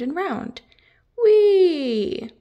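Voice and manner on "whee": high, loud whee of delight; gleeful whee. Acted. Hamish spinning in the salon chair, gleeful squeal.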